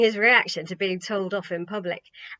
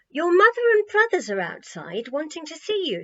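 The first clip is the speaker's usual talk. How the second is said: raised-pitch message; 7 semitones higher, 5 dB louder than usual. A narrator's reading. Tammy passes message to Jesus.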